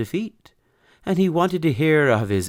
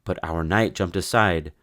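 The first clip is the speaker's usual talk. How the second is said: plain reading voice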